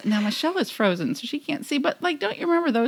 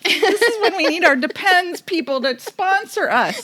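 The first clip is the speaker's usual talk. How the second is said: high, tearful laughing; laughing hard; 6 semitones higher than usual. Real, not acted. Host laughing so hard she can barely speak. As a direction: barely get the words out. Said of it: "I'm laughing so hard".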